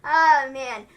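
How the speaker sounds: ordinary talk, nothing marked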